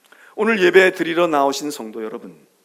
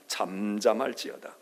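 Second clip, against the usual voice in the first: soft echo; 10 semitones lower, 7 dB quieter, slower than usual. Acted. Repeated scripture softly.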